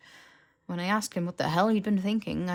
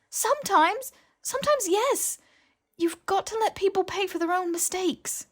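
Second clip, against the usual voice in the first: high, loud, insistent; sharp retort; 14 semitones higher, 5 dB louder than usual. Acted. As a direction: snap back firmly. Hermione's blunt retort.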